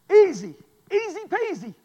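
loud, high, bouncy easy peasy; playful emphasis. Real, not acted. Preacher mocks how easy it is.

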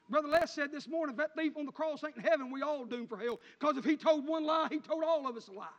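full-force preaching. Acted. Preacher quoting Brother Les to the church, ringing out loud. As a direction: lift the congregation with a ringing call.

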